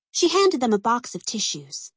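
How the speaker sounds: usual speaking voice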